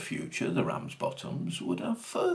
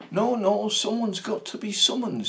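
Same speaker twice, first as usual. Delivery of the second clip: insistent protest; 11 semitones higher, 5 dB louder than usual. Acted. Mother turns awkward, insists.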